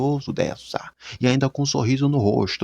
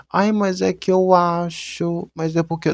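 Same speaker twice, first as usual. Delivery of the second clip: whiny excuse voice; 5 semitones higher, 3 dB louder, slower than usual. Retold or imitated. Mocking an excuse-maker.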